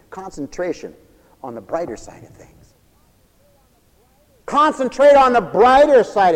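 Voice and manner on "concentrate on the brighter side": emphatic exhortation. Real, not acted.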